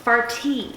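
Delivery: "Farty" with a crisp, airy T; affektiert vornehm. Shown as a demonstration. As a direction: say "Farty" daintily, with a carefully released T. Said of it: "You gotta say it like".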